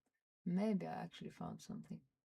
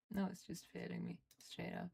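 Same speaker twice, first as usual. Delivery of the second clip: ordinary delivery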